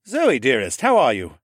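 a lightly voiced roguish uncle; Horus's voice. A narrator's reading. Horus cheering up his sick nephew, lightly teasing. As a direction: tease the boy kindly.

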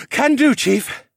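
puffed, loud, high reply; panting. A narrator's reading. Falcon Man carrying a passenger answers Alex, panting. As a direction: answer through heavy breaths. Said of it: "the Falcon Man panted".

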